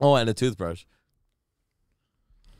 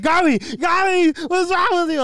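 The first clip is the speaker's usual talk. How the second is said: high, loud, slow mock dad shout; hammed-up dad yelling down the bathroom; 10 dB louder than usual. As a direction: yell his name like a panicked dad. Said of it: "and he's like".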